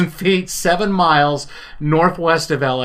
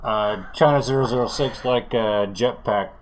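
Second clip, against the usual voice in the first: lightly voiced low pilot radio call; airline pilot; 4 semitones lower, 3 dB quieter than usual. Pilot replies to the tower's question, lightly voiced pilot. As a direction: put on a bit of a pilot voice. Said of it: "as the China Airlines zero zero six crew".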